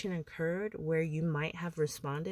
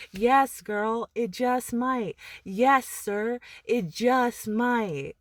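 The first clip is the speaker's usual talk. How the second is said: louder, higher, slow emphatic answer; 5 semitones higher, 7 dB louder, slower than usual. Real, not acted. Answering her own doubt to viewers, emphatic. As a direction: land it like a preacher's yes.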